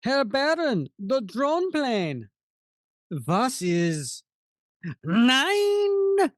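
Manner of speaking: shrill German cry of nine; German accent. Acted. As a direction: panicky German henchman. German henchman voiced in comic.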